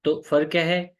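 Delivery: ordinary talk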